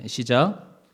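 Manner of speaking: ordinary delivery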